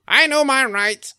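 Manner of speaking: slow smug I know my rights; mock an entitled driver. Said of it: "what do you hear every time".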